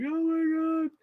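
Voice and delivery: squeaky high "oh my God"; starstruck gasp. Retold or imitated. Fan recalling a moment, high squeal of awe. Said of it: "I was just like".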